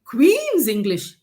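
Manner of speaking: high, loud, put-on voice of the locals; playfully imitating the locals' amazed compliment; mock impressed local. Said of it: "they said".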